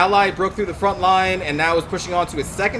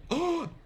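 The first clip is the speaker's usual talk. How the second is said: sudden high gasp of shock; shocked intake of breath; sharp gasp; 11 semitones higher, 9 dB quieter than usual. Real, not acted. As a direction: gasp as the mistake dawns. Realizes his costly mistake.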